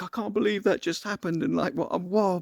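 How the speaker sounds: high-pitched, amazed disbelief; high, giddy retelling of shock; adrenaline rush. Retold or imitated. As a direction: act out buzzing after a narrow escape. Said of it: "the adrenaline's kicked in".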